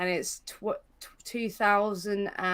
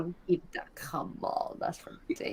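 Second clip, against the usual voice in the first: hushed protest under breath; whispering; 3 semitones lower, 11 dB quieter than usual. Real, not acted. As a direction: whisper it to yourself.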